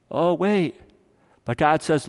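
ordinary talk, nothing marked

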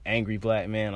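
ordinary delivery

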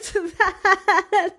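breaking into a high laugh mid-line. Real, not acted. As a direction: giggle at your own admission. Laughing at his own hopes.

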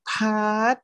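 high, slow, exasperated cry of a name; retold annoyance, pitched high; mock annoyed. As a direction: act fed up with the friend. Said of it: "โอ๊ย หัวเสีย".

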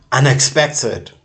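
loud; shouted; sudden shout. Acted. Sudden loud break in repetition.

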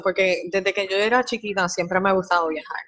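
normal talking voice

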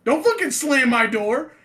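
high, forceful acted complaint; indignant telling-off. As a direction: re-enact calling out the door slammer. Retold telling-off at the door.

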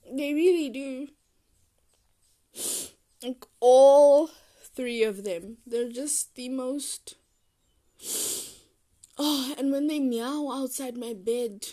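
rising, loud and gushing; excited gushing. Real, not acted. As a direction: gush about the cats with glee. Gushing loudly about cats.

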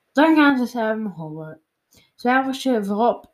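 ordinary delivery

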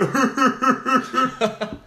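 bright burst of laughter; high laugh. Real, not acted. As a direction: laugh it off at the joke. Laughs at own mock.

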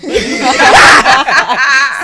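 big high burst of laughter; helpless loud cackle; loud shrieking laugh. Real, not acted. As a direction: crack up at full volume. Cracks up at the negative score.

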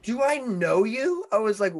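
very high, loud, stretched question; baffled disbelief. Retold or imitated. Acted bewildered reply to stranger.

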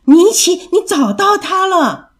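high, loud cry of discovery; excited cry. A narrator's reading. Child thinking mother found the lost toy, excited cry. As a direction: cry out high, stretching the words. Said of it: "波利大叫".

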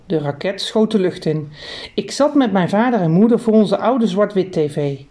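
ordinary delivery

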